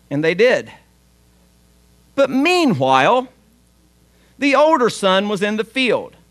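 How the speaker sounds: ordinary talk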